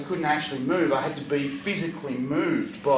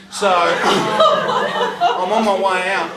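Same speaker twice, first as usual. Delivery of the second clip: louder, slow words laughed out; laughing through it; 5 semitones higher, 8 dB louder, much slower than usual. Real, not acted. Telling the audience about the escape, laughing through it. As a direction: laugh while you tell it.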